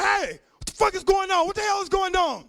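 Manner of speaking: loud, high furious yelling; a wife exploding at what she sees; angry shout. Retold or imitated. Wife catching him with three women, furious shout.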